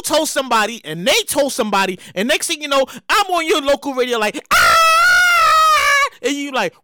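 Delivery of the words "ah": loud, high sustained scream; long high yell. Pretend. Host imagining himself on local radio, long mock scream. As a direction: hold one long high "Ah" at full voice.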